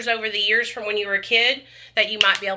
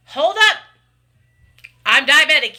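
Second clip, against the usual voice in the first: sharp, high and loud comeback; retold clapback; 5 semitones higher, 7 dB louder than usual. Vlogger retelling her reply to online critics, loud and indignant. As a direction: call out the haters, jumping up loud. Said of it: "And I'm like".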